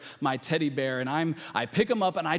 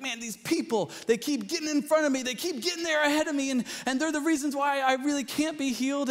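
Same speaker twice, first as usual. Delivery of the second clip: high complaining voice, blaming others; whiny excuse-making man; 10 semitones higher than usual. Retold or imitated. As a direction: whine like a man full of excuses. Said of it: "you can hear it in the man's voice".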